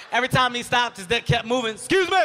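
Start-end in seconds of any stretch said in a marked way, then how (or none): 1.8-2.2 loud call. Retold or imitated. Dad calling out in school.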